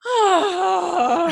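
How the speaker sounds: loud, high, drawn-out groan; exaggerated effort groan. Getting up from the chair, exaggerated loud groan. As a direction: heave a noisy "oh" of effort. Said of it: "I make, like, heaps of sound effects".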